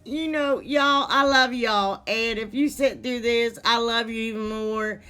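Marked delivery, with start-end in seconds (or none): 0.0-2.0 heartfelt. Real, not acted. Heartfelt thanks to viewers.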